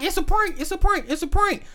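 high, frantic repeated plea; panicked pleading. Retold or imitated. Family frantically tells the dad it was a prank. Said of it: "They like".

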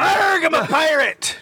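pirate catchphrase, slow and proud. Retold or imitated. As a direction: ham it up as a pirate. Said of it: "finish every audition with that".

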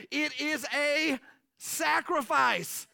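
slow, drawn-out stress on sacrifice; emphatic preaching. Real, not acted. Preacher driving home giving is hard, slowly. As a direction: lean on every syllable.

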